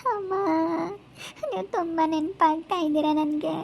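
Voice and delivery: put-on lonely girl weeping for her parents; tearful crying. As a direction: cry out for Appa and Amma, homesick and alone. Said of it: "ಪಾಪಚ್ಚಿ ಅಳ್ತಾ ಇದ್ದಾಳೆ".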